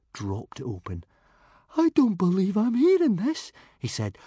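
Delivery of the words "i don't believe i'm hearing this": high, loud squeak of disbelief; appalled. A narrator's reading. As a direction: protest in panicked disbelief. Timid friend is appalled.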